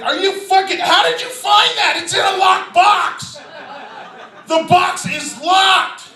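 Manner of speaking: high-pitched exasperated yelling; incredulous outburst. Retold or imitated. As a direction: act out a baffled dad losing it. Acted disbelief at child's discovery.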